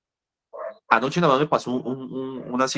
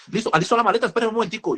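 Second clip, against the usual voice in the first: suddenly eager patient; 11 semitones higher, faster than usual. Retold or imitated.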